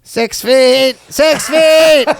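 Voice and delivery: high, loud mock yell building; shrill-voiced mock yell of distance rule; mock shout.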